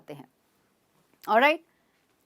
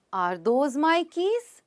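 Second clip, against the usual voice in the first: very slow question, "keys" going up; rising question tone; 3 semitones higher, much slower than usual. Shown as a demonstration. Teacher giving intonation practice, tone rising on "keys". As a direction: stretch it and lift "keys" at the end. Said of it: "practice करना इंटोनेशंस की भी।".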